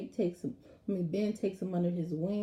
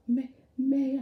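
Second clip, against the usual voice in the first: higher, louder, prim correction; correcting grandmother; 6 semitones higher, 4 dB louder than usual. Retold or imitated. Grandmother corrects grandson's grammar, prim. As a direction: fix his grammar like a stern granny. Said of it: "she just goes".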